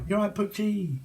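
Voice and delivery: high, sing-song mock greeting. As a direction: tease with a fake-friendly high hello. Mockingly greeting the email writer.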